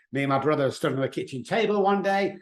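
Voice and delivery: ordinary delivery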